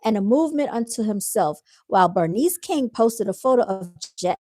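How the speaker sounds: ordinary talk, nothing marked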